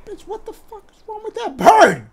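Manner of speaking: high, shocked outburst; shocked gamer outburst; shocked disbelief. Real, not acted. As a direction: react in stunned disbelief at the bird. Shocked at strange bird.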